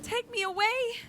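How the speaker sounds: high, tearful pleading; crying plea. Acted. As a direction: beg through tears. Crying girl begs.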